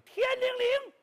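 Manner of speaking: high, ringing call to the heavens; incantation cry. Acted. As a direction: shout the spell like a sorcerer. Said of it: "大喊一声".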